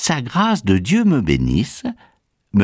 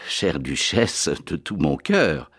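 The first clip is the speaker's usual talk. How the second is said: woo her in a lowered, unhurried voice; gallant sincerity; 4 semitones lower, 4 dB quieter than usual. Acted. Galanterie à la duchesse. (galanterie tendre, voix grave et lente)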